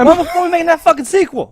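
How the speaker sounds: high, loud impersonated yell; Sam Jackson. Retold or imitated. Cohost retells the actor pestering the director, imitated yelling. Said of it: "he just yells".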